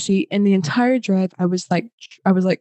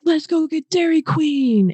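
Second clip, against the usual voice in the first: high, drawn-out eager call; excited group cheer; 8 semitones higher than usual. Retold or imitated. Everyone rallying for a trip to Dairy Queen, excited.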